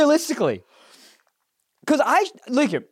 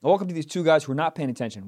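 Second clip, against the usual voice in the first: usual speaking voice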